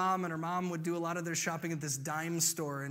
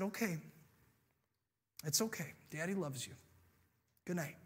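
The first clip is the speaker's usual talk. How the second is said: soft, tender, unhurried; reassuring father; 7 dB quieter, faster than usual. Acted. Dad says good night to upset daughter, tenderly. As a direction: accept it quietly and soothe the child.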